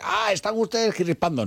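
high-pitched feigned indignation; mock politician complaint. Politician using the Pope's appeal against critics, mock indignation. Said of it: "para decir".

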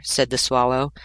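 plain reading voice

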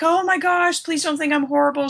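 high-pitched pleading, retold; imitated mortified apology. Wedding planner apologizing to the couple, mortified.

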